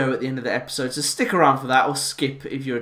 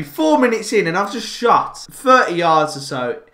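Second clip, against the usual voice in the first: ordinary talk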